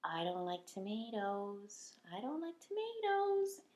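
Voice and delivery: high, fussy puppet whine; a picky puppet refusing tomatoes, high mock whine. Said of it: "and go".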